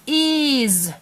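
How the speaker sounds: "is" ending in a buzzed z; said as a word. Shown as a demonstration. Parent shows child how to say the word, "is" with a z. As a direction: say "is" as izz. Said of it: "Here's how we say the word".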